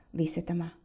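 ordinary delivery, nothing marked

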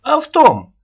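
very high, loud retort; shrill nagging grandma. Acted. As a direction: fling it back like an angry nanny. Аннушка quarrels.

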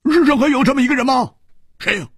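high, loud, eager demand; loud eager question. Acted. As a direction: call out in excitement. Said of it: "胡铁花大声说".

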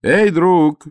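loud, high call upward; trying to lure the guard over; shout. Acted. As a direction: yell up to the guard. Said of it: "крикнул я".

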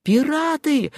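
high, drawn-out warning; alarm cry. A narrator's reading. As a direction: take up the cry, pitched high. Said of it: "подхватил Фомич, и его крик". (высокий тревожный крик)

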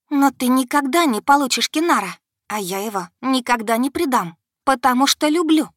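loud, high declaration of love; defiant. A narrator's reading. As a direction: fling it at her proudly. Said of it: "Девчонка воинственно сдула с лица прядь". (воинственно и громко)

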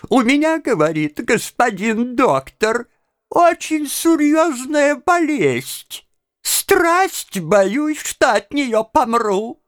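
put-on high, slow old woman complaining to the doctor; a frail old lady fretting about her illness. Old woman telling the doctor her fears, in a high voice. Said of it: "Приходит к доктору старушка".

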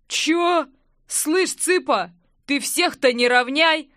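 tough guy taking offence, loud; slow, challenging protest; indignant. A narrator's reading. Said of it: "возмутился здоровяк".

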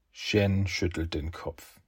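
ordinary delivery, nothing marked

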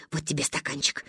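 low, soft practical voice; instructing grandmother. A narrator's reading. Ba giving granddaughter a cup, low matter-of-fact voice.

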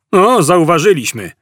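high-pitched holler back; shouted retort. A narrator's reading. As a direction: call back across the hall. (odkrzyknięta riposta)

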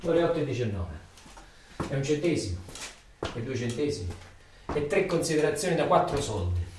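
hushed, low and heavy; low, quiet countdown. Acted. Ritornello cupo del turno. (conto alla rovescia sommesso e cupo)

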